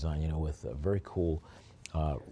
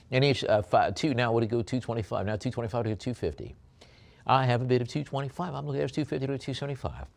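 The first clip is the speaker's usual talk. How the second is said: fast, higher bid chant; auctioneer calling bids; 4 semitones higher, faster than usual. Acted. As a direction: rattle through the increments.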